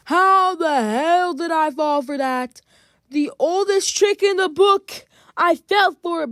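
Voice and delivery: loud, high cry of disbelief; exasperated outburst. Real, not acted. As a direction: loudly kick yourself for falling for it. Outraged at falling for game trick.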